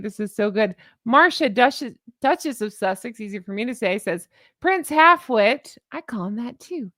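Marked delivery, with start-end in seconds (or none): 4.6-5.8 drawn-out, louder higher "Prince Halfwit"; mock-grand "Prince Halfwit"; relished insult. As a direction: savour each word of "Prince Halfwit". Reading insult quote with relish.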